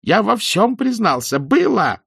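very high, loud hysterical scream; hysterical shout. Acted. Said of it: "Истерично закричал Гилевич". (истерический крик)

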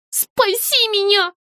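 high, slow, scared cry for help; frightened plea. A narrator's reading. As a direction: beg in a frightened squeak. Злюня в панике просит. (испуганная мольба, высоко и медленно)

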